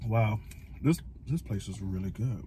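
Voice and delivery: chewing while talking; speaking between bites; mouth full of noodles. Real, not acted. Seen 1: Slurps noodles just before; mouth seems busy, chopsticks in bowl while speaking.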